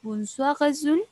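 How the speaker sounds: "Bonsoir, Azul" linked by a French R, "Azul" lifted high; liaison francesa. Retold or imitated. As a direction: sing out "bon-swa-razul", rising on "Azul". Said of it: "ella hacía la liaison". ("Bonsoir, Azul" enlazado con R francesa, subiendo en "Azul")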